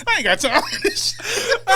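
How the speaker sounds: high, slow, shouted through laughter; laughing. Pretend. Mock waitress fed up with the order, laughing hard. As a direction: scream it while cracking up. Cracking up over waitress bit.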